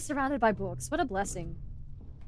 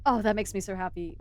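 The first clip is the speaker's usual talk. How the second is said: usual speaking voice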